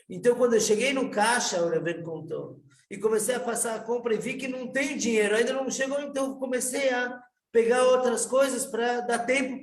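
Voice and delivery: a poor man bursting out about his miracle; excited retelling. Retold or imitated. Reuven tells of his miracle. (empolgado, voz mais aguda e mais forte, aliviado)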